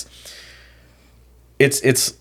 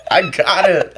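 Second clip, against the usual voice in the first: laughing; 10 semitones higher, 3 dB louder, slower than usual. Real, not acted. Still laughing.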